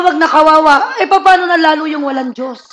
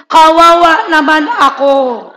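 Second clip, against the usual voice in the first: slow, louder unison read of Kawawa naman ako; slow congregational reading; 5 dB louder, slower than usual. Shown as a demonstration. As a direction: lead the crowd slowly, leaning on kawawa. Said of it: "basahin natin to ng sabay-sabay".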